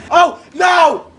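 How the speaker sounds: high, loud "oh no"; dismayed shout. Real, not acted. Player who almost had it, shouting in dismay. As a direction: shout as it slips away. Failing in the game.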